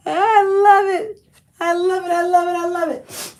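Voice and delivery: delighted gushing. Real, not acted. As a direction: swoon over the find. Gushing over a found dress.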